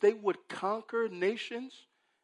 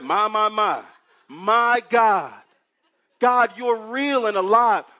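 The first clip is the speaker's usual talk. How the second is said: loud, high crying out My, my, my; 3 semitones higher, 9 dB louder than usual. Acted. Preacher voicing Habakkuk, loud and high soul cry. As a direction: belt it like a soul singer calling on God. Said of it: "He takes a page from [name]'s book".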